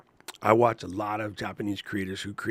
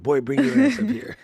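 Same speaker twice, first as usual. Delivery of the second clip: stern grandma; 10 semitones higher, 6 dB louder than usual. Retold or imitated. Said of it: "Grandma looking at me like".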